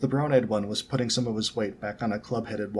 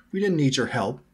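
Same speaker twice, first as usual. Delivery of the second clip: loud, higher, indignant bark; barked shortly; 4 semitones higher, 6 dB louder than usual. A narrator's reading. Ronnie rejects the boys' help, barked shortly. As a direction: snap back in offence. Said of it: "she barked shortly".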